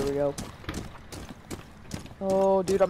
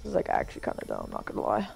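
muttered; 10 semitones lower, 8 dB quieter than usual. Real, not acted. Muttered complaint.

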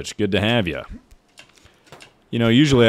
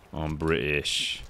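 low, soft, slow British accent; drawn-out attempt at a British accent; 4 semitones lower, 8 dB quieter, slower than usual. Shown as a demonstration. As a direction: try a hushed British voice. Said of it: "Can you do a British accent?".

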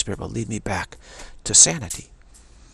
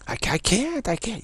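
high whiny goddess; 12 semitones higher than usual. Retold or imitated. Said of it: "acted goddess reply".

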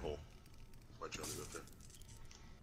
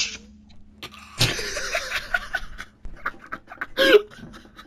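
high airy witch cackle; 8 dB louder than usual. Pretend. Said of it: "I can know about witch noise".

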